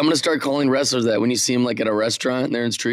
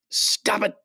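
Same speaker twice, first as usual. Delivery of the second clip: put-on high-pitched stab at a French Canadian accent; half-hearted French Canadian wrestler accent; attempted Kevin Owens accent; 10 semitones higher than usual. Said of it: "the accent is. I can't do it".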